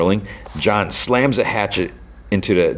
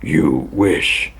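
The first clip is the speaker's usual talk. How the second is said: rough voice. Retold or imitated. Character retorting in a retold fight, rough voice. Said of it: "and a rough voice".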